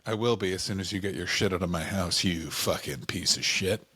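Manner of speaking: low, slowing, contained anger; acted angry spouse. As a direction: play a fed-up partner cursing in a low voice. Acted break-up quarrel between couple.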